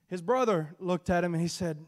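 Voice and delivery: usual speaking voice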